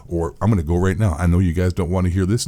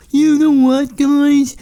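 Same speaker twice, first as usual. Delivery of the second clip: loud, much higher whiny cheater; mocking cheater voice; 7 dB louder than usual. Vlogger mocking banned cheaters' excuse, loud high whine. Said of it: "people love to use as an excuse".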